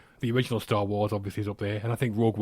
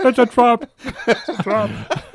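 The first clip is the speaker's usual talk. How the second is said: high, loud mock film-character cry; hammed-up high movie impression; mock shouted quote; 11 semitones higher, 7 dB louder, slower than usual. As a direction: parody the famous warning, laughing. Repeating the film line as impression.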